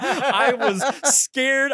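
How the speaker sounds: very slow and laughing; laughing, drawn out. Real, not acted. Defending himself to his co-host, laughing slowly. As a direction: stretch it out through laughter.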